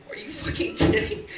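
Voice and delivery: high, breathless disbelief; incredulous. Real, not acted. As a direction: gasp it out in disbelief.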